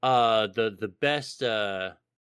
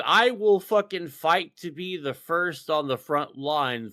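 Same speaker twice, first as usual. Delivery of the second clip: ordinary delivery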